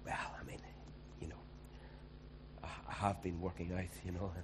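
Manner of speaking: sheepish half-whisper, falling to soft; a coy, quiet mock-humble husband; bashful husband. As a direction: modestly brush it off in a hushed voice. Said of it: "he's going".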